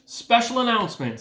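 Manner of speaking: high, grand loudspeaker voice; pompous announcer. A narrator's reading. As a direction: announce it with pomp. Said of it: "said the loudspeaker in a pompous voice".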